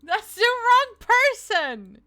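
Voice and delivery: high, loud, slow shocked cry; stunned, drawn out and loud. Real, not acted. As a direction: cry it out in disbelief. Shocked at the wrong voice.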